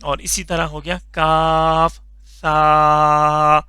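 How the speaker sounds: کاف سا read slowly with a thin, light سا; thin kaf sa. Shown as a demonstration. Teacher shows a light sa after kaf, slowly. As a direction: stretch کاف سا, keeping سا thin. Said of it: "سا کو بالکل پتلا کر کے پڑھنا ہے".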